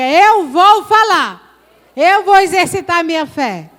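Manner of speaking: slow, loud declaration; declaration for the congregation to repeat. Shown as a demonstration. Preacher leading the church in a loud slow declaration. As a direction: proclaim it for all to repeat.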